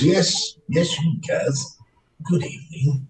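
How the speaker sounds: usual speaking voice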